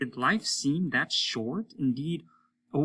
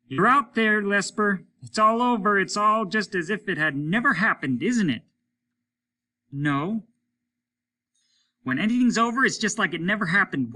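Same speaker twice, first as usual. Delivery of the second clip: high, loud jeering at him; mean taunting; 10 semitones higher, 8 dB louder than usual. A narrator's reading. Hollis jeers at Lesper that life meant nothing, loud and mean. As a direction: push him to admit it. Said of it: "He was being mean".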